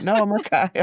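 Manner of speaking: high, breezy "no, I'm okay"; lightly voiced brush-off. Guest brushing off her husband in a high breezy voice. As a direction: wave him off sweetly.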